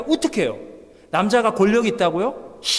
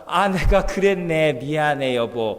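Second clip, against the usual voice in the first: mild apology; 9 semitones lower, slower than usual. Retold or imitated. Changed husband apologizing.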